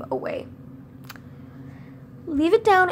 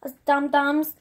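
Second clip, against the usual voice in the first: ordinary talk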